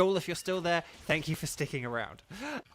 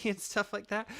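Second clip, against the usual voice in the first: normal talking voice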